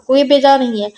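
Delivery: ordinary delivery, nothing marked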